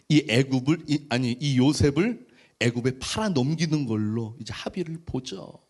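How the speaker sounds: normal talking voice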